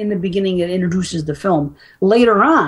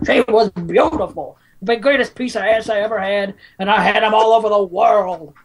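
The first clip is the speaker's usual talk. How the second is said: lightly hammed-up movie quote; actor impersonation; 3 semitones higher than usual. Fan quoting Jack Waltz's Godfather lines, impersonation. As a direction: imitate a character actor relishing his lines. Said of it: "I can fucking do this guy's lines".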